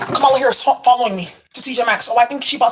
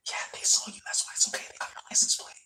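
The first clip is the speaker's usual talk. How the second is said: whisper; 14 dB quieter than usual. Acted. Secret warning to viewers.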